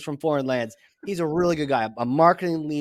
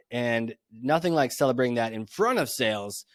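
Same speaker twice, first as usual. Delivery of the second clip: ordinary talk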